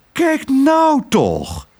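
high, drawn-out cry of surprise; excited cry. Acted. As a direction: call out in delighted disbelief. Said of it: "riep ze".

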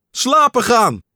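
high, loud startled shout of slapen gaan; loud high cry of slapen gaan. A narrator's reading. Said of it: "schreeuwde Pat".

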